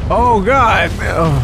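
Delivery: sudden loud high yelp of alarm; startled cry. Real, not acted. Gamer startled by a sudden attack, yelping loud and high. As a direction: jolt out a startled oh God.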